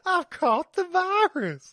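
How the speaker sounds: slow, high, loud cowboy drawl. Acted. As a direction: drag it out like a cowpoke. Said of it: "Cowboy Voice Week".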